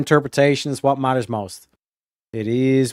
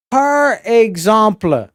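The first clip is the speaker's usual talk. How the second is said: par exemple said in a hammed-up French accent, slow; mock French; 6 semitones higher than usual. Host introducing a meme in hammed-up French. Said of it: "mock French phrase".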